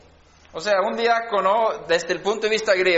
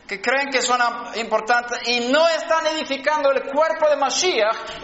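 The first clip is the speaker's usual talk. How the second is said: fiery prayer; 5 semitones higher than usual. Acted. Prays against religious empires.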